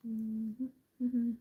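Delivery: humming with something held in the mouth; stitch marker in mouth. Real, not acted. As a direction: hum through a clenched item. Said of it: "I put the stitch marker in my mouth".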